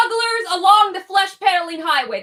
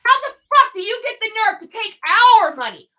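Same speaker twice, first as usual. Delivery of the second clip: ordinary delivery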